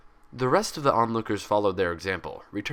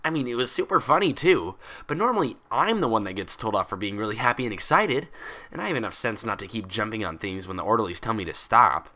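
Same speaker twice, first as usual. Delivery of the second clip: bubbly Pinkie Pie voice; 2 semitones higher than usual. Acted. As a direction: chatter it out like an excitable pony.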